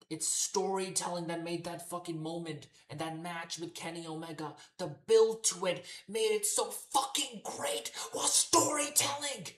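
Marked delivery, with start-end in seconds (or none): 4.8-8.3 furious shouting rant, much higher and louder; real exasperated yell; losing it. Real, not acted. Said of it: "I'm losing my shit". 8.3-9.5 storytelling yelled high and loud, slow; real angry shout; furious shout. Real, not acted. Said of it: "I'm losing my shit".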